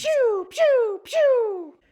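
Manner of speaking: very high piercing pew pew; laser gun noise. Acted. Boy fires his toy laser at aliens, very high pew pew. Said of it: "made the sound of a laser gun".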